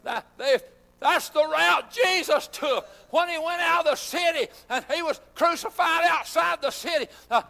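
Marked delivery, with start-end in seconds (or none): 1.0-7.1 raised, fervent preaching shout; preaching at full force. Acted. Preacher lifting his voice to the congregation, fervent. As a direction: lift it up like a revival preacher.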